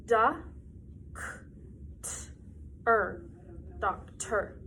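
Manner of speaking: "Da, k, t, er" split into separate sounds, then blended into "doctor"; sounding out. Shown as a demonstration. Teacher sounding out "doctor" with the class. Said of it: "can everybody say it with me?".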